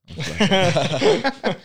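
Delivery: high, slow, laughing it out. Real, not acted. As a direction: giggle through the words. Host repeats, laughing.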